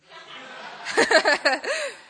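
high-pitched burst of laughter; real laugh. Real, not acted. Student speaker asking group for a selfie, laughing.

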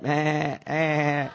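imitated sheep "meh"; sheep bleat. Sheep answering the robber's false call, bleat. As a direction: bleat back like a fooled sheep. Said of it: "the sheep are like".

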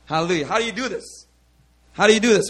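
normal talking voice

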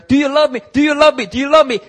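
high, loud repeated demand; acted insistent questioning. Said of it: "So when Jesus is asking Peter".

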